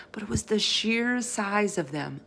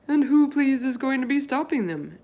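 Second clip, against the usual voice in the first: folksy giant voice, a touch higher; BFG voice; 8 semitones higher, 5 dB louder than usual. Acted. Giant explaining to Sophie what the others do, folksy. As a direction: play the big friendly giant, chatty and earnest.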